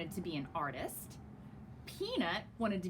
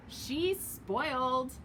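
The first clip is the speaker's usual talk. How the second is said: very slow, louder drawn-out sneer; slow teasing jab; 3 semitones higher, 4 dB louder, much slower than usual. Acted. Waffle tells Soup slowly, sneering. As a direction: drag the word out to tease.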